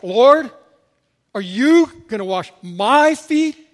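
YOU and MY hit loud and high, slowly; loud incredulous question punching "you" and "my"; stress on YOU and MY. Acted. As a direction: lean hard on "you" and "my" in disbelief. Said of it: "All of the emphasis falls".

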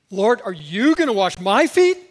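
acted disciple's shocked objection; incredulous protest. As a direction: ask it loudly in disbelief. Reading Peter's protest to Jesus.

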